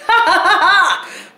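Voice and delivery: very high, loud cackling ha-ha-ha; loud mocking laugh. Mother reenacting laughing alone in the courthouse restroom, loud and high. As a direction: burst out laughing at the absurdity. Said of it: "just was like".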